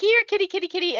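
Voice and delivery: high singsong kitty call; calling a cat. Retold or imitated. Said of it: "and being like".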